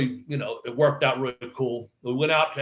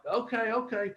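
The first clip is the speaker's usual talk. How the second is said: high, soft acquiescence; retold meek agreement; 8 semitones higher, 6 dB quieter than usual. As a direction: give in meekly, higher and softer. Retold uneasy giving in.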